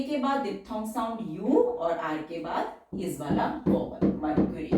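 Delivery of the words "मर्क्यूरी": usual speaking voice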